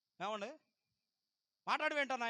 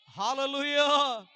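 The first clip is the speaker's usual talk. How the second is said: drawn-out hallelujah, much louder and slow; raised voice; 5 semitones higher, 14 dB louder, much slower than usual. Shown as a demonstration. Pastor leading the congregation's praise, in a loud drawn-out hallelujah. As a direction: lift the voice and stretch it out. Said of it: "గట్టిగా స్వరమెత్తి".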